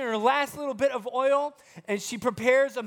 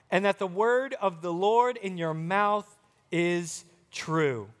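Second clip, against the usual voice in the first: solemn Bible reading, unhurried; scripture reading; 4 semitones lower than usual. Acted. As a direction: read the verse with gravity. Reads scripture aloud.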